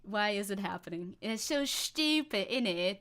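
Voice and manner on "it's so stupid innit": slow, put-on British accent; jokey British accent. Streamer joking to chat in a mock British accent. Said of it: "we wanted to do this all in British accent".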